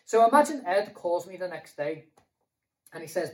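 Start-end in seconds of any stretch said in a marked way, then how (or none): none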